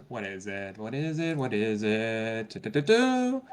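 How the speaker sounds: soft sing-song thinking aloud; murmuring to oneself. Real, not acted. Player trying to recall a perk's name, murmuring to themselves. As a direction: fill the pause quietly, half humming.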